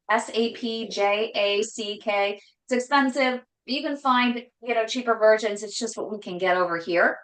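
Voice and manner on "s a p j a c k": slow, each letter of S A P J A C K said separately; spelled out letters. Shown as a demonstration.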